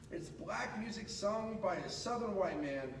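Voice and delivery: plain reading voice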